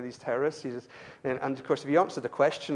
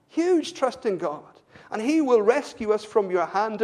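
loud, high-pitched pulpit fervour; impassioned preaching; 8 semitones higher, 7 dB louder than usual. Real, not acted. Preacher telling of three men defying the king, impassioned. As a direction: proclaim their trust at full force. Preaches Shadrach story at force.

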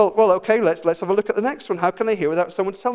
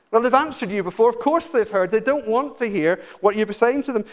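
usual speaking voice

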